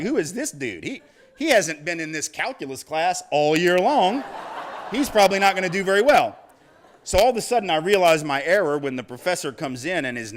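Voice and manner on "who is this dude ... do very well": mocking classmates. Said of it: "they're all looking at me like".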